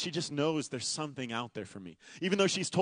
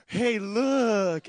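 put-on toddler quote; 5 semitones higher, 5 dB louder than usual. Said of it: "and he goes".

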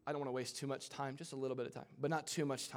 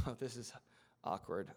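low, soft, awkward mumble; sheepish aside; 5 semitones lower, 7 dB quieter than usual. Acted. Jonah caught out by the lots, sheepish and quiet. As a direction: shrink back with a small laugh.

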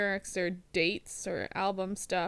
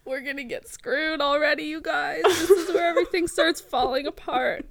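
laughing; 7 semitones higher, 7 dB louder than usual. Real, not acted. Mock panic about recording plans.

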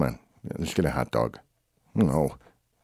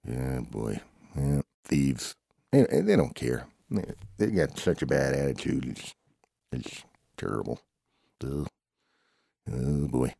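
ordinary talk, nothing marked

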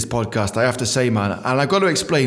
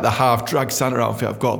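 ordinary talk, nothing marked

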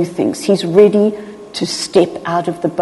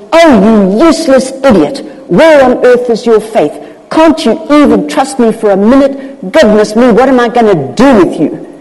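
loud, exasperated telling-off; hammed-up angry rebuke; mock chastising; 3 semitones higher, 6 dB louder than usual. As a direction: scold hard, as if fed up with a hopeless pupil. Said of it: "He's not chastising at all".

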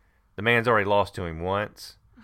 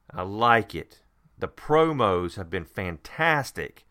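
emphatic, enthusiastic fan praise; real excitement, pitch lifting on the highlights; excited; 3 semitones higher than usual. Real, not acted. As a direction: gush over it, punching the key words. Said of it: "So I'm extremely excited, as you can tell".

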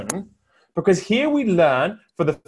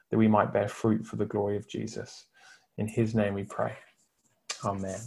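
prayer; 5 semitones lower than usual. Acted. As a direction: pray gently over the listeners. Leads a prayer.

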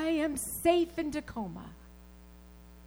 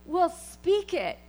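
forceful preaching; 5 semitones higher, 6 dB louder than usual. Acted. Preacher declaring faith to congregation, full-force preaching. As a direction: proclaim it loud and high, full of faith.

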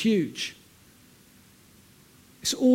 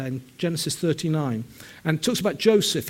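usual speaking voice

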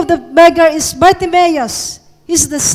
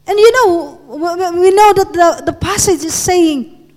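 ordinary talk, nothing marked

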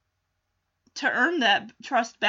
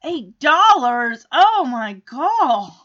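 slow, drawn-out disbelief; loud, appalled and lingering; incredulous; 5 dB louder, much slower than usual. Real, not acted. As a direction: stretch each word in exasperated shock. Host reacts to water price.

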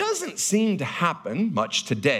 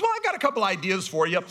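put-on higher, giggly child pitching her ideas; little daughter voice; 7 semitones higher, faster than usual. Daughter offering dad story ideas, giggly and higher. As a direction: play the eager kid with a laugh in it. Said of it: "or she goes".

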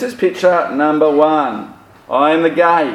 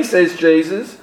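ordinary delivery

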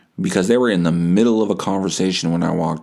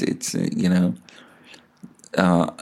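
ordinary talk, nothing marked

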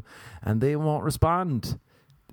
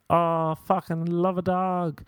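high, slow, drawn-out gush; mock gushing; 8 semitones higher, slower than usual. Mimicking people who adore all dogs, mock gushing. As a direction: overdo the dog-lover adoration. Mocks gushing dog lovers.

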